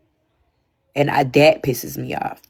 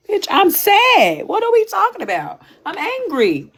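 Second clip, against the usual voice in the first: mock crying. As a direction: wail it like an upset crybaby answering back. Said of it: "Why are you crying?".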